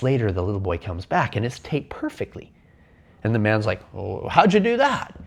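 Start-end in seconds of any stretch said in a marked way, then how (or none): 3.9-5.2 much higher, louder surprised exclaim; genuinely floored by the kid; amazed dad. Retold or imitated. Dad seeing the map finished: higher, louder astonishment.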